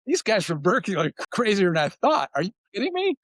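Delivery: mock incredulous rivals. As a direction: act out the opponents' disbelief, climbing up in pitch. Rival players mocking Cal team.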